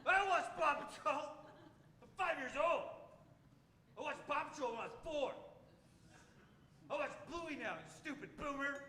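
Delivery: put-on a five-year-old scorning a grown-up; offended little kid. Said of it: "And they're like".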